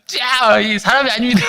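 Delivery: high mock verdict dissolving into laughter; mock indignation, laughing. Evangelist protesting to God in prayer, retold indignant and laughing. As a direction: declare it in mock protest, cracking up.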